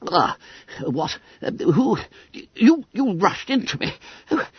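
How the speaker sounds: high, breathless fussy little man; gurgling winded secretary. Acted. Little secretary knocked flat, spluttering high and breathless. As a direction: splutter like a winded old clerk. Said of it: "Gurgled Mister Twiss".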